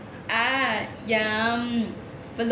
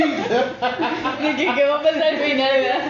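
loud, higher mock suspense with laughter; intriga fingida; 3 semitones higher, 5 dB louder, slower than usual. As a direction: play the hooked listener, laughing. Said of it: "con cara de intriga".

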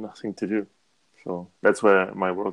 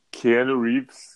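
slow, dramatic name reveal; drawn out; 5 dB louder, much slower than usual. Acted. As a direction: stretch the name out for effect.

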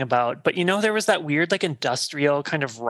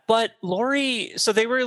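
ordinary delivery

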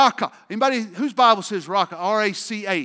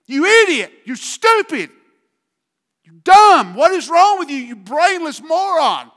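furious name-calling; 8 semitones higher, 9 dB louder than usual. Acted. Preacher acting out insults that kill self-esteem. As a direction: shout them down.

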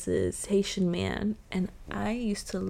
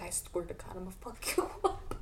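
muttered threat; 8 dB quieter than usual. Retold or imitated. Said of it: "she was like".

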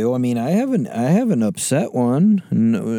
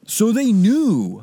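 higher, slow, drawn-out repeat; slow emphatic repeat; 10 semitones higher, much slower than usual. Pretend. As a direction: stretch it out mockingly. Repeat, slower.

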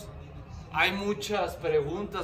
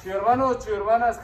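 excited greeting; 6 semitones higher, 8 dB louder than usual. Real, not acted. Host greets viewers loudly.

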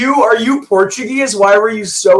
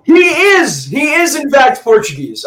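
jumps higher and shouts the news; excited reveal; 7 semitones higher than usual. Real, not acted. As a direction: announce the answer with a burst of relief. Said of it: "Close call there".

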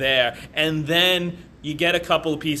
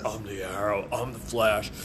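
deeper, lower disguise voice; Oliver type; 4 semitones lower, 4 dB quieter than usual. Acted. Co-host suggests a disguise for Barry, deep Oliver voice. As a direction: put on a low superhero voice. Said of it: "deeper, like, Oliver type".

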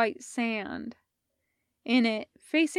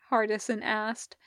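ordinary delivery, nothing marked

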